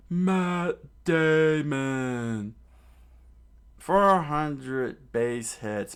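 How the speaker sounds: very slow, high, loud strange chant; Mister Four's battle cry. Acted. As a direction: drag each word out as a cartoon villain. Mister Four hitting balls.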